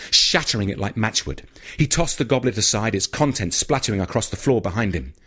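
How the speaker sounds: usual speaking voice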